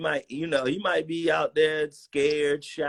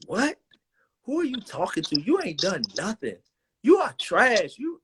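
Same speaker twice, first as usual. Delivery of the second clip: angry put-down; 8 semitones higher than usual. Retold or imitated. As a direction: mimic a senior player tearing into a rookie. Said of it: "he went on me".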